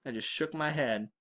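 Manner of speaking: ordinary delivery, nothing marked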